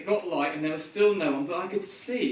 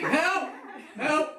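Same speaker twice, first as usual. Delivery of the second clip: shouting for help; 8 semitones higher than usual. Retold or imitated. Stuck on a cliff, shouting help to walkers above. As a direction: call out to hikers on the path. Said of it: "And I was shouting".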